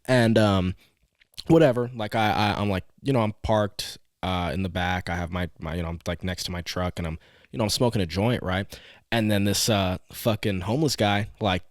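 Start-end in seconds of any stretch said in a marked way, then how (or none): none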